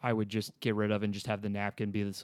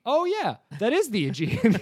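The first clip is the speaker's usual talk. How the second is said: lightly imitating Kathryn Hahn, "Oh" jumped far higher; mock dawning realization; 10 semitones higher, 5 dB louder than usual. Imitating Kathryn Hahn's character finally getting the Aegean joke, high and delighted. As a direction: put it together out loud with delighted surprise. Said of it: "I love Kathryn Hahn".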